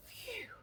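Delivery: breathy whispered phew; a soft sigh of relief; relieved phew. A narrator's reading. Wolf relieved he blends in.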